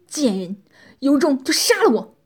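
loud, explosive defiant outburst; furious cursing. A narrator's reading. Bound man cursing his captor, loud furious defiance. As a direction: hurl abuse at full force, defiantly. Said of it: "破口大骂".

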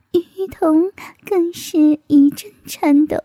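ordinary delivery, nothing marked

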